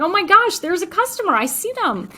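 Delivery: high-pitched, thrilled customer-spotting squeal; excited gushing. Retold or imitated. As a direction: burst out like you've just spotted a fan. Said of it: "I just get so excited".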